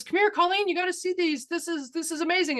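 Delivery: high, eager call to come look; calling her daughter over, thrilled; excited mom. Retold or imitated. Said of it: "My mom goes".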